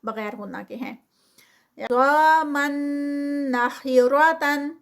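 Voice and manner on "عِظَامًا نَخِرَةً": عِظَامًا نَخِرَةً recited higher and louder with a heavy ظ and خ; slow نَخِرَةً with a heavy خ and ر; Quranic recitation. Shown as a demonstration. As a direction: chant it slowly as a tajweed drill. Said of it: "بالغنہ".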